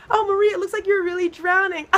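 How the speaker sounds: high voice laughing through the words; laughing taunt. Retold or imitated. Friend mocking Marie while she drowns, laughing. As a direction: giggle it out while she sinks.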